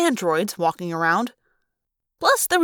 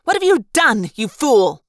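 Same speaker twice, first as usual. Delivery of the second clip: much higher and louder, furious rebuke; angry outburst; 4 semitones higher, 6 dB louder than usual. A narrator's reading. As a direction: shout down the fool. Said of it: "one of the Chi demanded".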